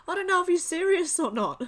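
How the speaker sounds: high, loud, breaking into laughter; real giggly disbelief; on the edge of cracking up; amused disbelief. Real, not acted. Cracking up over the post.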